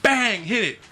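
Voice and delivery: usual speaking voice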